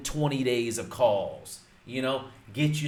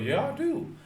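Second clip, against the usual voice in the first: homeowner reply. Shown as a demonstration. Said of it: "You'd be like".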